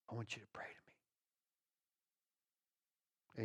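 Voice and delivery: hushed whisper into the ear; Holy Spirit whisper. Acted. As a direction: whisper it as an inner prompting. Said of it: "The Holy Spirit says in your right ear".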